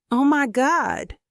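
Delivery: high, drawn-out exclamation; crying out. A narrator's reading. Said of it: "cries Leslie".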